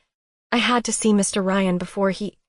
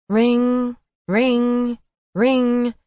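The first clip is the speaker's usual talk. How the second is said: phone ringing; 5 semitones higher, 5 dB louder, much slower than usual. Acted. Phone ringing wakes narrator.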